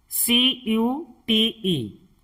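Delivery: letters spelled out slowly, one by one; slow letter-by-letter spelling. Shown as a demonstration. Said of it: "इसे कुटे या कटे नहीं पढ़ेंगे".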